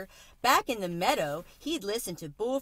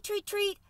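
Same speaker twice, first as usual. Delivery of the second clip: high chirping treat, treat, much higher; imitated cricket chirp; 13 semitones higher than usual. Cricket imitating how people say crickets sound, high chirp. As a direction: chirp the words like an insect. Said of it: "others say treat".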